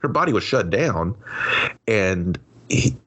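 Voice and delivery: ordinary talk, nothing marked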